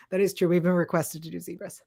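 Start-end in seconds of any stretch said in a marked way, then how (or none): none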